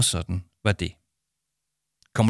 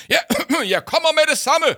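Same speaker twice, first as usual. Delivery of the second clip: much higher and louder, near a shout; almost shouting; 7 dB louder than usual. Acted. Paul into the phone, chocolate cake waiting, near shouting. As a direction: burst out into the phone. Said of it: "nærmest råbte Paul ind i telefonen".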